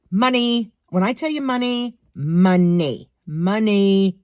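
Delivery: "money" hit hard and drawn out; emphatic repetition. Real, not acted. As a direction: punch each "money" as its own statement. Stressing how rich the man is.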